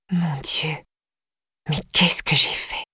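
soft unvoiced whisper of guilt; horrified whisper. A narrator's reading. Flora whispers to herself in guilty shock. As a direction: breathe it out in shock. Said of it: "Et je me dis".

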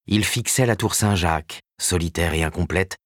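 usual speaking voice

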